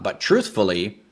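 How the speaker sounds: usual speaking voice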